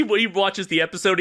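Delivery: ordinary talk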